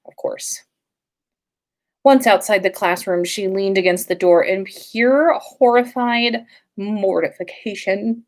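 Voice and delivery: plain reading voice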